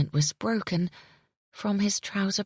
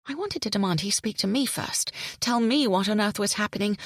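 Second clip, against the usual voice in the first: plain reading voice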